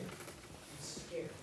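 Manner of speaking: soft admission of fear; whispered confession. Real, not acted. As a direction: let the fear slip out barely voiced. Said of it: "I was terrified".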